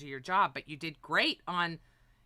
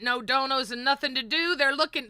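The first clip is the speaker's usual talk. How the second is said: ordinary delivery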